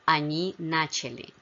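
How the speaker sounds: начали stressed on the first syllable, НАчали; stress on stem. Shown as a demonstration. Teacher models plural past of начать, stress on first syllable. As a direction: hit НА at the start of начали. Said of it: "the stress only shifts in the feminine".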